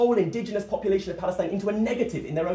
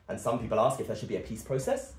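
ordinary talk

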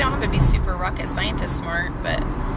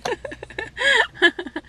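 high giggle. Real, not acted. Laughing after teasing sister.

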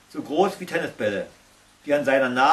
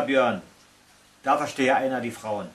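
usual speaking voice

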